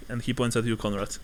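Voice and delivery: ordinary talk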